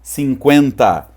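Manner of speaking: normal talking voice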